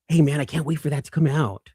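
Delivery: ordinary delivery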